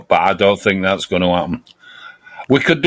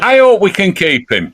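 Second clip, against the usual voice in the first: hopeful; 8 semitones higher than usual. Real, not acted. Hopeful plea about player.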